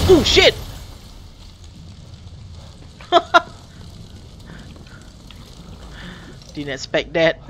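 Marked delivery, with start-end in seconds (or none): 0.1-0.5 loud startled shout. Real, not acted. Startled by jumpscare. 0.6-6.5 breathy laugh. Real, not acted. Laughs after scare.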